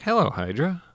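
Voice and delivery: bright, high friendly greeting. Retold or imitated. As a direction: greet them like old friends. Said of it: "like your friends".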